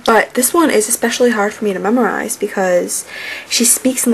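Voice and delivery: ordinary talk, nothing marked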